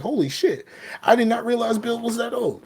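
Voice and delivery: high-pitched, playful disbelief; astonished reaction. Retold or imitated. Retelling his shock at Bill's age.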